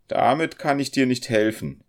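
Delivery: high, loud, unhelpful refusal; surly landlady's curt reply; grim. A narrator's reading. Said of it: "entgegnete sie finster".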